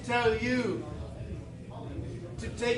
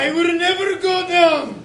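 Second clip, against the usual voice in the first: booming defiance; 7 semitones higher, 10 dB louder than usual. Acted. Undead elf resists.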